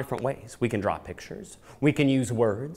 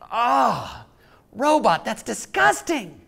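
much higher, slow grossed-out voice; playfully disgusted; 11 semitones higher, 4 dB louder, slower than usual. Acted. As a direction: act grossed out for the kids. Reacting to robot's toot.

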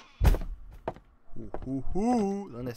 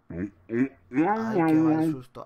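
slow loud moan after the scare; drawn-out fright; 4 semitones higher, 11 dB louder, much slower than usual. Real, not acted. Player recovering from a scare, slow loud fearful moan. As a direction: groan out the shock.

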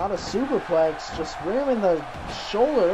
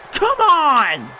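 outraged shout; 6 semitones higher, 5 dB louder than usual. Real, not acted. Outraged protest.

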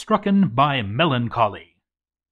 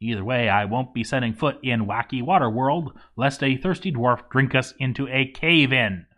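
usual speaking voice

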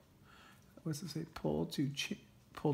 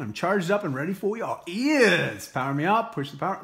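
playful gadget voice; 3 semitones higher, 6 dB louder than usual. Pretend. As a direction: let the earbuds speak proudly. Voicing earbuds asking to charge.

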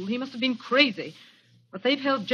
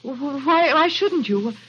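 stammering, rising higher and louder; she's scared and can't get the words out; frightened stammer; 5 semitones higher, 3 dB louder than usual. Acted. Dorothy realising Rolfe won't let her leave, stammering in fear.

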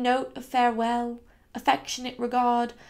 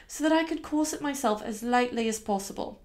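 usual speaking voice

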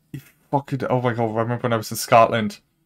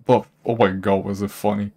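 normal talking voice

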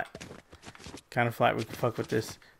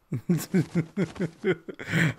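laugh; 3 semitones higher, 3 dB quieter than usual. Real, not acted. Laughing at failed attempt.

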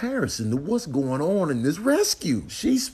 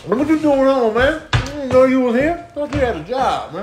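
character voice; 9 semitones higher than usual. Acted.

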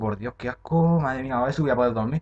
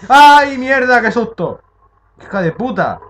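shrieking, much higher and much louder; jump-scare scream; 13 semitones higher, 14 dB louder, slower than usual. Real, not acted. Gamer ambushed by a monster, screaming in real fright.

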